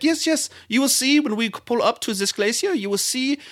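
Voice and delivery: put-on high-pitched guide in a slipping Icelandic-German accent; mock Icelandic accent, drifting towards German; Icelandic accent turning German. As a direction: imitate a European tour guide, vaguely Germanic. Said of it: "it's kind of German now".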